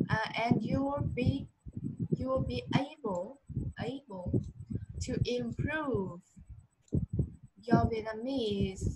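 slow, word by word, "will" rising; slow stressed demo. Shown as a demonstration. Teacher slowly models rising on key words. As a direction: stretch "to improve" slowly and drop on "your". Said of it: "chỗ đoạn use là mình lên".

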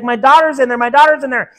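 high, loud, desperate cry of a father; frantic shout. Acted. Father shouting at the firemen outside the burning house, frantic. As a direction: shout it like your child is trapped inside.

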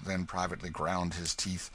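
plain reading voice

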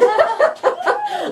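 high giggle; squeaky delighted giggle; giddy laugh. Real, not acted. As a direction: laugh at your own glee. Giddy laugh after her yay.

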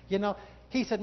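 ordinary delivery

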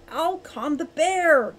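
loud, high, stretched-out call; urgent shout. A narrator's reading. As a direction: call out to stop the attack. Falcon shouts to halt the strike.

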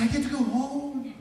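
high, loud mock-crying outburst; tearful joy. Preacher acting his teen self telling mom, crying for joy. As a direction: wail it as happy tears. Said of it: "I'm crying".

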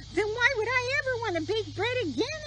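high incredulous hen; exasperated hen. Acted. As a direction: protest it in disbelief. Hen protests.

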